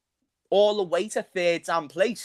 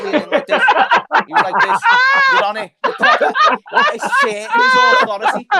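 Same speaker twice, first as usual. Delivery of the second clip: high, loud, hyped mimicry; imitating the player; 8 dB louder than usual. Acting out the defender's lean-in for his mates, loud and high. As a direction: act out his lean-in with a squeal. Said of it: "see the way he was leaning in".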